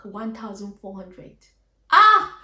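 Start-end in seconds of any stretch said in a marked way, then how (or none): 1.9-2.4 loud, high "Ah!" of exasperation; frustrated outcry. Retold or imitated. Reliving the loss of hard-earned money, frustrated yell. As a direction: burst out with a sharp cry.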